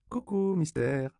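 high, drawn-out cuckoo call; little girl calling out slowly, pitched high; child's playful greeting. A narrator's reading. Child greets the man, singsong.

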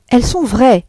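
an impatient wife contradicts her husband. A narrator's reading. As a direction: snap back, fed up with him. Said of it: "répliqua madame Arnould impatientée".

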